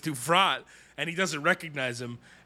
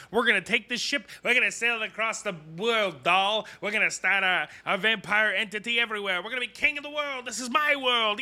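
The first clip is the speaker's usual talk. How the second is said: raised-pitch boastful villain rant; hammed-up vampire villain; 6 semitones higher than usual. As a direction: crow about ruling the world, mock grand. Villain's grandiose speech retold.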